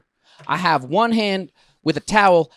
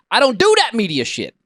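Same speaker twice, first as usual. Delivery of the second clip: raised-pitch irritated outburst; indignant, higher-pitched dismissal; worked up; 7 semitones higher, 3 dB louder than usual. Real, not acted. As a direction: get heated mid-rant. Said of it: "Sorry, I'm getting worked up".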